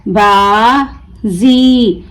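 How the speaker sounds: बाजी very slow, "baa", pause, long "zee"; broken into syllables. Shown as a demonstration. Teacher breaking the word for learners, बाजी stretched as baa... zee. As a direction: draw out बाजी as two separate syllables with a z. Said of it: "तोड़ तोड़ कर पढ़ूंगी".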